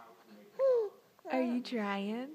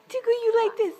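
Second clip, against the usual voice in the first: higher, louder playful teasing; playful tickle voice; 8 semitones higher, 7 dB louder, faster than usual. Real, not acted.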